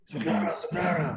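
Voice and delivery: impassioned address to the congregation; emotional reading. Shown as a demonstration. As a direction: read it out with some emotion, pitch lifted. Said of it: "akan membaca dengan cara sedikit emosional".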